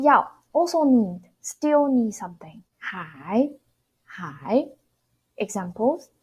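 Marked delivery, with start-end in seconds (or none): 2.8-4.7 还 said slowly and deliberately; 还 modeled for learners, slowly; 还 spoken clearly, held for imitation; careful model. Shown as a demonstration. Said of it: "repeating the word slowly".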